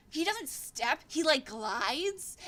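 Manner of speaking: usual speaking voice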